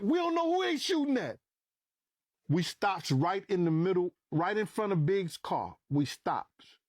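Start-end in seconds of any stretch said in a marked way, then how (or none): 0.0-1.4 very high, urgent retort; retold alarmed reply. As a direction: reenact arguing under gunfire. Retold panicked reply during shooting.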